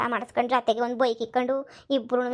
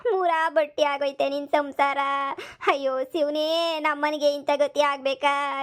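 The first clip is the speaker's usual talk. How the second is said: slow, drawn-out lament with stretched vowels; theatrical wailing; slower than usual. Acted. Mother lamenting her daughter's ruined household, wailing. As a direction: wail each phrase out like a dirge. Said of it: "ನೀನ್ ಯಾಕೆ ಹಿಂಗ್ ಗುಳಾಡ್ಕೊಂಡು ಹೊಳ್ತಿದ್ಯಾ".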